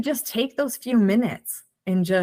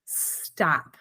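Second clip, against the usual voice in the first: inner command; 4 semitones lower, 8 dB quieter than usual. Retold or imitated. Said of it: "I knew right then and there".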